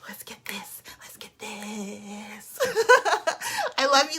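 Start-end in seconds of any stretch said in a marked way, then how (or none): 0.1-0.8 soft whisper, rallying the team. Real, not acted. Whispered closing pep line. 1.0-2.6 very slow, drawn-out whisper. Real, not acted. Repeated, slower whisper. 2.6-3.7 bright high-pitched burst of laughter; high giggle. Real, not acted. Laugh after the whisper.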